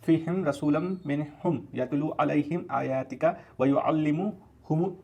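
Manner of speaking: slow, drawn-out chanted Arabic recitation; Quran recitation. Shown as a demonstration. Teacher recites the Quran verse for his students, slow measured chant. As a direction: recite the verse slowly, stretching every vowel. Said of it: "मैं एक बार Arabic में go through करता हूँ".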